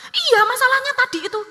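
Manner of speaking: loud, very high-pitched imitation of irritation; escalating snappy retort. Child arguing back at startled mother, heated. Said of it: "Anak saya tambah ngegas lagi".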